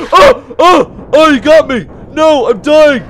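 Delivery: loud, high, desperate cries; real frantic shouting; panicked yelling. Real, not acted. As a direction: yell as if caught and running for life. Caught by monster, dying, fleeing.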